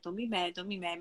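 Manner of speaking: calming self-talk. Retold or imitated. A mom soothing herself after the headbutt, in soft self-talk. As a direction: talk yourself down under your breath. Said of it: "And I was like".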